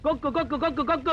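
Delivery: high, rapid-fire repeated alert; excited spotting. Acted. Spotting the bird, urgent. (பரபரப்பாக, உயர்ந்த குரலில்)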